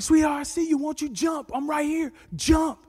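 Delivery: high, urgent calling; retold pleading call, pitched high; father calling out. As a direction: urge a child to jump from afar. Father urges daughter to jump.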